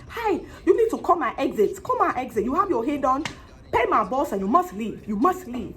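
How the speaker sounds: a hint of the West African braider giving orders; West African accent. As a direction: West African accent demanding payment and departure. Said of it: "excuse me, accent".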